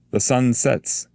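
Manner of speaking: a bright herald's warning; angel's voice. Acted.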